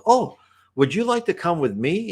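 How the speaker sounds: high-pitched, friendly invitation; child spirit inviting. Retold or imitated. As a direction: lightly voice a child inviting a playmate.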